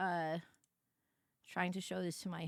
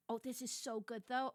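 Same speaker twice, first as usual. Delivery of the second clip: ordinary talk, nothing marked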